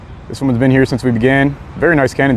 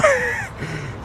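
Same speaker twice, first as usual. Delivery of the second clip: high laugh; 10 dB quieter than usual. Real, not acted. Laughing mid-sentence.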